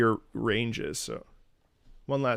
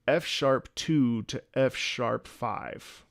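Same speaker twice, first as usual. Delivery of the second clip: ordinary talk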